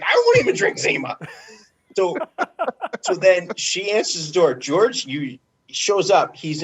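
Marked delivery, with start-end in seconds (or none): 0.0-1.2 high-pitched imitation of a girlfriend; mock disbelief, jumping up high. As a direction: imitate her scoffing in a higher voice. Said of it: "Like, she's like".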